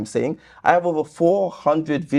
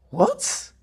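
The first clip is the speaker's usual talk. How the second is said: high, incredulous squeak of disbelief; baffled what; 5 dB quieter than usual. Real, not acted. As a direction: react as if the comment makes no sense at all. Baffled by a comment's claim.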